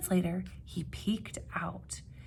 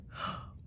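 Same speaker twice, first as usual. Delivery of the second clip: startled gasp; 10 dB quieter than usual. Acted. Animals startled.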